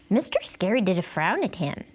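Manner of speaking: usual speaking voice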